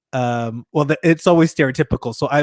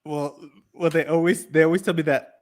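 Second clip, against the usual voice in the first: ordinary talk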